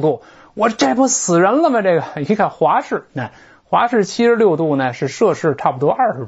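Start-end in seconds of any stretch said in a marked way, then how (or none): 0.5-1.8 mock alarm. Recalling shock at 76 degrees.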